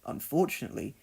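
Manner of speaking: ordinary delivery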